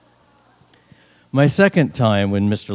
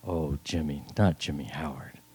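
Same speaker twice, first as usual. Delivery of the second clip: low, soft, tender reply; hushed, low-pitched motherly voice; gentle mother; 7 semitones lower, 10 dB quieter than usual. Acted. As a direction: answer softly as a loving mother. Mother near death answers her son.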